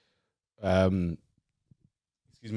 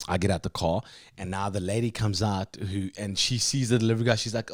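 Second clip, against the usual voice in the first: ordinary delivery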